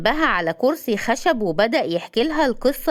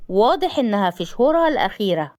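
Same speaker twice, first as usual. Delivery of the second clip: ordinary delivery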